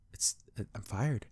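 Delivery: very soft, slow, halting; stunned dismay. Retold or imitated. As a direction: imitate someone quietly taking in terrible news. Retelling Amity's shock at firing.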